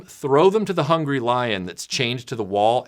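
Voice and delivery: ordinary delivery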